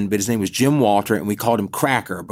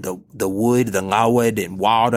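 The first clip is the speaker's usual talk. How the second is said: put-on deep South Alabama accent. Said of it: "he would say things like".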